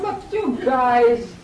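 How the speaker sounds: loud, high, drawn-out exasperated plea; emotional exasperation. Acted. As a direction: scold disappointed followers, stretching the words. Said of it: "it's so emotional".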